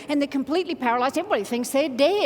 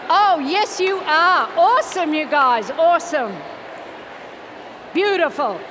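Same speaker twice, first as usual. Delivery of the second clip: loud, raised, emphatic praise; cheering encouragement; 5 dB louder than usual. Real, not acted. Coach praises the crowd after their exercise, cheering loudly. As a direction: call out approval over a busy room.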